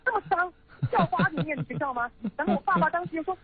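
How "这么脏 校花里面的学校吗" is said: high-pitched indignant mom, disgusted questions; angry mother. Retold or imitated. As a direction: imitate an appalled mother's complaint, pitched way up. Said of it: "爸爸妈妈非常非常生气".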